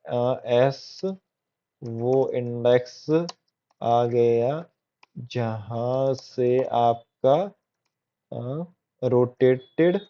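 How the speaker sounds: very slow, word by word with long gaps; writing-along pace. Real, not acted. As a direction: say it slowly as you write it out. Dictating to self while writing.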